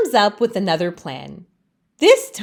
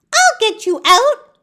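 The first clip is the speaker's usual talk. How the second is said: much higher, bright helper voice; a cheerful friend with a plan, pitched way up; eager friend Laurel; 14 semitones higher, 6 dB louder than usual. Acted. Laurel encourages Leaf, much higher voice. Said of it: "Laurel says".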